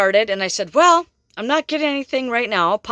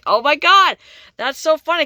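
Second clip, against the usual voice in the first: much louder and higher, bursting with delight; 7 semitones higher, 6 dB louder than usual. Retold or imitated. Reader delighted by the prankster dad, loud glee. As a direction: let the joke land with glee. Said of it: "And I'm like".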